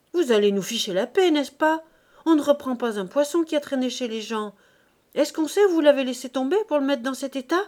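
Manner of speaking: heated market-stall quarrel; angry scolding. Acted. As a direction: tear into the customer. Fishwife rises to quarrel with maid.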